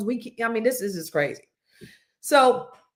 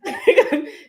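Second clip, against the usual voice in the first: loud laugh breaking out; high burst of laughter; 5 dB louder than usual. Real, not acted. Host laughing at the Boston banter, high and loud.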